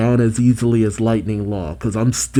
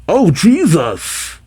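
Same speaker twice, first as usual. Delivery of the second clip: surprised; 6 semitones higher, slower than usual. Acted. Dramatic end of quote.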